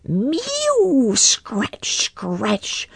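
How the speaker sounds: high mew then scratching sounds; cat scratching. A narrator's reading. Simpkin scratching at the window, high mew. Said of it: "scuffled Simpkin".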